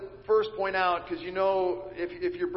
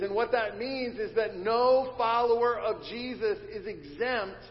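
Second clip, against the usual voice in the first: ordinary talk, nothing marked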